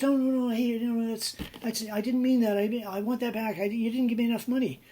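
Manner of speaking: upset protest. Retold or imitated. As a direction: beg to undo the sale in a rush. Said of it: "And the fellow says".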